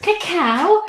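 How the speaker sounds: high, rising query; questioning echo. Real, not acted. Chatting about chocolate, querying a technical word, surprised. Queries friend's unfamiliar word.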